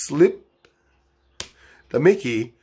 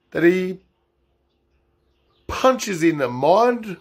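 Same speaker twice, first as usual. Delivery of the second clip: ordinary talk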